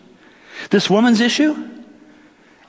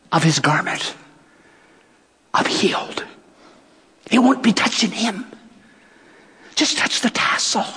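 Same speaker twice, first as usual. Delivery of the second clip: hushed whisper. Acted. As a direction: whisper it so nobody hears. Woman's secret inner thought.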